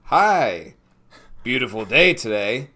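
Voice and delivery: loud, stilted canned NPC greetings; Oblivion townsperson. Acted. Townsperson repeating stock greetings, loud hammed-up NPC voice. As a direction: greet each passer-by like a scripted game character. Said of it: "any town in Oblivion".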